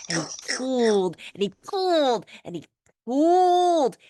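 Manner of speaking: rising effort; building pulls. A narrator's reading. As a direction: strain harder each time. Building tug of war.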